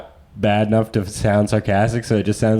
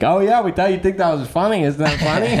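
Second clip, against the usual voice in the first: higher, innocent put-on tone; mock sheepish excuse; 8 semitones higher than usual. The boys to the annoyed hosts, mock innocent excuse. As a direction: play dumb, hoping it's funny.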